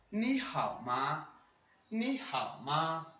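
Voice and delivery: ni hao ma drawn out slowly; slow Mandarin demo. Shown as a demonstration. Teacher repeating the question for students. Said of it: "você tá bem?".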